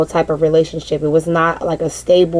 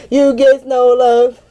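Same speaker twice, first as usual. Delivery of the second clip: laughing; 7 semitones higher, slower than usual. Real, not acted. Laughing at her own line.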